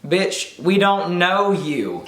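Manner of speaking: high, slow sassy put-down; drawn out, full of attitude; sassy retort. Pretend. Mock sassy retort to "Hey.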